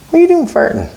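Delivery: wife's accusing voice. Retold or imitated. Husband imitating his wife's accusation, high and squeaky. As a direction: play the wife catching him out, squeaky and shocked. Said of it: "baby looks at me and goes".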